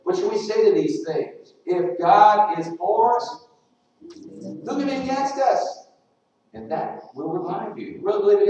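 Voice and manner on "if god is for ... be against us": slow, higher, each word weighted; preached emphasis. Acted. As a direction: deliver the verse slowly as the climax. Preaching the key verse.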